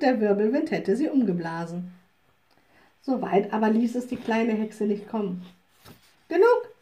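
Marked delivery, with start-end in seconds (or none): 6.3-6.7 much higher, loud command; little witch orders the whirlwind to stop; commanding shout. Acted. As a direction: stop the wind with one sharp call. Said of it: "rief sie".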